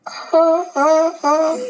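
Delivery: high, loud repeated yelps; wolf's pain cries. Acted. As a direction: yelp as the wolf hit on the tail. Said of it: "the wolf said".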